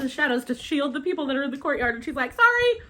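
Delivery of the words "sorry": very high, loud sing-out apology; sheepish sorry. Retold or imitated. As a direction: squeal a mock apology. Apologising as debris falls after strike.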